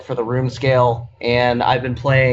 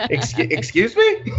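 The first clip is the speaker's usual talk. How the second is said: high, shocked "excuse me"; startled, offended coworker voice; 11 semitones higher than usual. Retold or imitated. Coworker's shocked reply acted.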